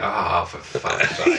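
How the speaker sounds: slow, low, deflated curse; drawn-out disappointed curse, low and soft; fed-up groan. Retold or imitated. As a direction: let the air out in disappointment. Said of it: "you go".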